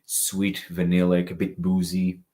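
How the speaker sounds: usual speaking voice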